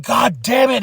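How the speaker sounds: mock yell. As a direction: imitate an exasperated outburst. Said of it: "He's yelling at Bronson".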